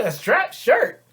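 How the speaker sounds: STRAP SHIRT thrown back high and loud; incredulous echo. Acted. Man hearing her complaint about "strap shirt", incredulous echo. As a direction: shoot up in disbelief on "strap shirt".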